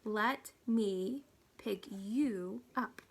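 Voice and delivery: each word stretched out very slowly; slow, careful, patient. Acted. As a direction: spell it out slowly for someone who barely speaks English. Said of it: "said very slowly".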